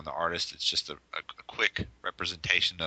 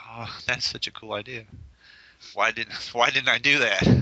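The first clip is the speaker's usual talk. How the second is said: laughing mock envy; 5 semitones higher, 6 dB louder than usual. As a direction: laugh through it, playfully jealous. Friend's envious retort, laughing.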